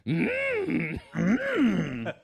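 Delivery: long creaky hum, drawn out; Yoda. Shown as a demonstration. Host revealing the secret of the impression, Yoda hum. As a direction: demonstrate the little sage's strained hum. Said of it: "to the Yoda impression".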